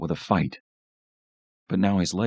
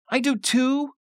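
put-on very high small-child retort; squeaky little-kid comeback; indignant little girl. A narrator's reading. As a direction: insist like an offended five-year-old. Little Tina contradicts her brother.

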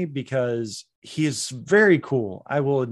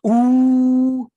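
long, high, loud held "ooh"; awed "ooh"; 12 semitones higher, 9 dB louder than usual. Retold or imitated. Viewer reacting to the cowboy hat, impressed ooh. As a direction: let out a drawn-out ooh at the reveal.

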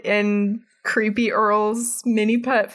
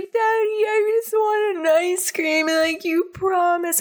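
put-on whiny little girl; 11 semitones higher than usual. Angelica whines to her daddy about ice cream, high pleading child. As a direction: whine at daddy like a spoiled toddler.

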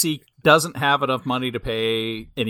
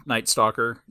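ordinary delivery

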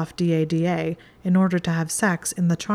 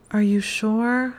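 slow, higher-pitched plea; pleading; 5 semitones higher, slower than usual. A narrator's reading. Hermione reluctant at the door, pleading with Malfoy. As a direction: draw it out, begging gently. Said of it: "Her voice was pleading".

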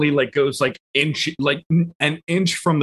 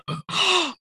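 excited gasp. Pretend. Reacting to pterodactyl king idea.

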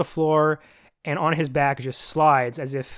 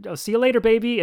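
sweet talking voice; 7 semitones higher, 4 dB louder than usual. Retold or imitated. [name] fakes date on phone.

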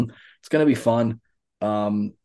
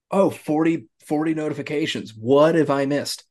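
mock surprise; 4 semitones higher than usual. Acts out seeing forty notifications.

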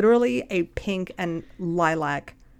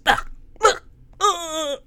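disgusted groans; 10 semitones higher, much slower than usual. Retold or imitated. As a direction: groan in disgust at each worm. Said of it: "all you hear is".

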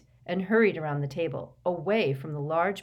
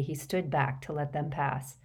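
usual speaking voice

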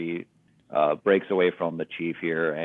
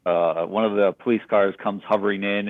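normal talking voice

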